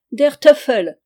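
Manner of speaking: loud, high German oath with a laugh; laughing exclamation. A narrator's reading. The German knight mocking the king, loud laughing oath. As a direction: bark it out, amused. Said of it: "S'écria l'Allemand en riant".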